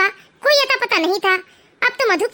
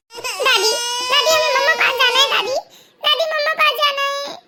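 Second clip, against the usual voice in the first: put-on a small child whimpering, pitched up; tearful kid begging grandma; crying to grandma for mummy; crying little child; 3 semitones higher than usual.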